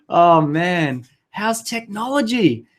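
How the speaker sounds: normal talking voice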